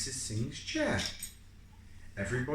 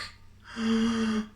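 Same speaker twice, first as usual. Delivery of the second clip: gasp; 11 semitones higher, 3 dB louder than usual. Acted.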